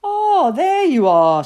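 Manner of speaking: high, loud, drawn-out exclamation; relieved mother's greeting. A narrator's reading. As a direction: welcome the wanderer home with a big cry. Mother greets returning child.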